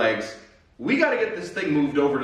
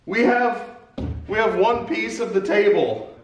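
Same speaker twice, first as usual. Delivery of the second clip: excited; 6 semitones higher than usual. Real, not acted. Excited at first result.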